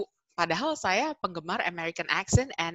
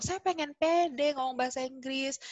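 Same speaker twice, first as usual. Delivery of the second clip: high-pitched mock boast of a learner; eager wish; 7 semitones higher than usual. Acting a boastful would-be learner. (berkoar-koar dengan nada tinggi)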